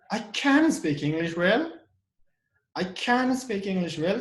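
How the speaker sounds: "can" lifted high and strong; stress on CAN. Shown as a demonstration. Answering someone who doubts their English, emphatic stress on "can". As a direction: insist with a punched "can". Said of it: "to show the emphasis".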